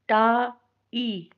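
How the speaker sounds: "टा, ई" split into a long aa, then ee; double vowel sound. Shown as a demonstration. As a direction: sound out both halves of the diphthong separately in "टा, ई". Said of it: "double sound आ रही है".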